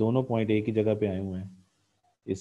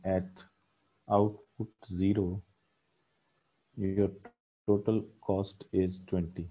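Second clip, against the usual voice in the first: slow, word by word dictation so students can write; teacher spells out the line for notes, very slowly; dictating slowly; 3 dB quieter, much slower than usual. Shown as a demonstration. Teacher dictating to the class, slow and spaced. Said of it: "तो आप लिख सकते हैं".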